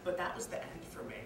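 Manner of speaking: usual speaking voice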